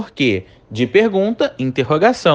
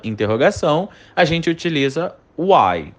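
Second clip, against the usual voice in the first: ordinary delivery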